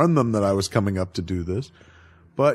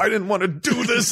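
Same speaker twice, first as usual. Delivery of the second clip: mock Bruce; 10 semitones higher than usual. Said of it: "And he's choking her".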